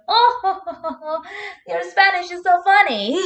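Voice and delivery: high, laughing mock voice. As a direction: imitate them laughing at you. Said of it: "they laughed at my Spanish".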